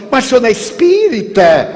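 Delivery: how can you have problems; shocked stranger. Retold or imitated. (estranho chocado, pergunta aguda e rápida)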